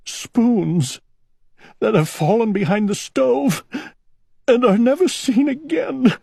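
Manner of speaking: rising high, weepy voice; crying. A narrator's reading. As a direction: wail it out, tears coming. Owl crying into the kettle.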